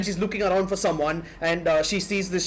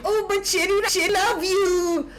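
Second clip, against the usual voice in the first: high, slow, lovestruck falsetto with a laugh in it; swooning husband; 13 semitones higher, slower than usual. Retold or imitated. Husband gushing to his second wife, high lovestruck falsetto. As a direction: gush it like a besotted husband in a romance. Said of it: "And he's like".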